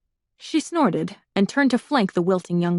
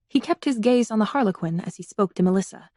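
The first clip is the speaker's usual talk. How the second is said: ordinary delivery, nothing marked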